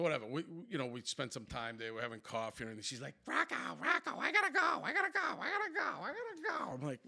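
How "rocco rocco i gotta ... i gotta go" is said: high-pitched, repetitive mock nagging; chirping girl. Girl badgering Rocco to leave, high mock chirping. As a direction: squeal it over and over like a chirping bird. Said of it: "The whole time chirping away".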